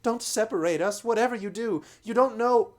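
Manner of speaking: high, loud desperate plea; pleading girl. A narrator's reading. Lucy begging the slavers not to separate them, high and pleading. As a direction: beg them not to part you. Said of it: "broke in Lucy".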